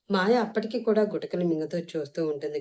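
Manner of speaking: ordinary delivery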